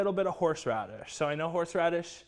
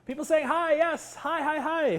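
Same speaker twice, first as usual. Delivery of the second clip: gasp, then bright high greetings, much higher and louder; delighted gasp; 11 semitones higher, 5 dB louder than usual. Real, not acted. Host greeting the chat viewers, gasping with delight. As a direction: greet everyone in one happy burst.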